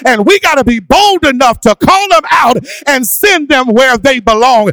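loud, high, sung-out sermon cadence; preaching at full force. Acted. A pastor calling his church to face demons, fervent and loud. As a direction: drive it home with whooping fervour.

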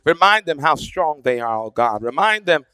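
public prayer building in force, then easing at the close; fervent prayer. Real, not acted. Preacher praying to God for those at the gate, fervently. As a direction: pray aloud with conviction, pressing each plea.

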